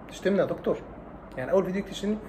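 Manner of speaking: a quiet, wounded student protest. Retold or imitated. Student protesting to the doctor about being insulted, softly hurt. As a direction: sound mildly offended, not angry.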